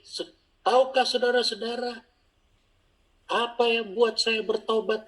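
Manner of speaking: high-pitched proclaiming question; sibling preaching. Retold or imitated. Sibling addresses congregation in first sermon, high declaiming. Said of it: "dia bilang gini".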